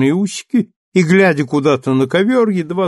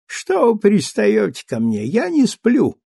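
lazy, higher voice burring L and R; lazy thin burring voice; 8 semitones higher than usual. A narrator's reading. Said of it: "ленивый тоненький голосок, приятно картавя на буквах «Л» и «Р»". (ленивый тоненький голосок, картавя)

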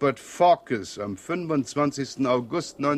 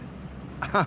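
soft chuckle; 8 semitones higher, 5 dB quieter than usual. Acted. Inspector chuckles.

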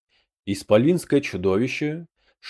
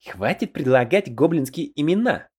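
mildly indignant goblin voice, raised in pitch; 4 semitones higher than usual. A narrator's reading. Мурло to fellow goblins suggesting names, indignant. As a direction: object crossly in a put-on character voice. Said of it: "возмутился Мурло".

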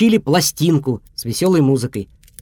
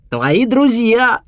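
higher slow cheerful call; cheerful wolf; 8 semitones higher, much slower than usual. A narrator's reading. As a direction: announce the friends. Wolf continues greeting.

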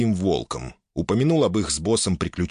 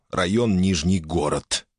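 usual speaking voice

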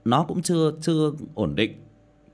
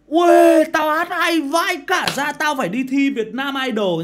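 put-on high, loud boastful kid; cocky kid bragging; 9 dB louder than usual. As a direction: crow about your own singing. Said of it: "cháu chui trong nhà tắm hát".

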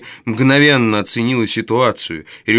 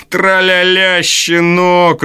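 high, louder gloating sing-song; gleeful yell; 7 semitones higher, 4 dB louder, much slower than usual. A narrator's reading. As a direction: crow it out in triumph. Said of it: "радостно заорал Ной". (радостный, злорадный выкрик)

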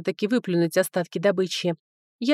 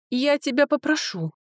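higher-pitched offended warning; indignant; 7 semitones higher than usual. A narrator's reading. Young woman rebuking the mocking dwarf, indignant. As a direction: raise the pitch in affronted protest. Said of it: "Я возмущённо надвинулась на Брэга".

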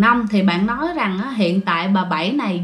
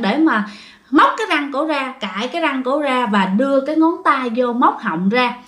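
ordinary delivery

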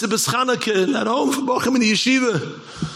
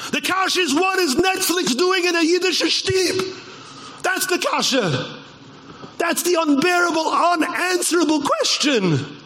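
outraged shout; 7 semitones higher than usual. Real, not acted. Rabbi confronting his community, shouting in outrage. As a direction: demand the answer from the crowd.

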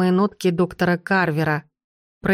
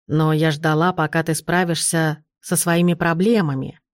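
ordinary delivery, nothing marked

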